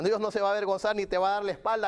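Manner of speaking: normal talking voice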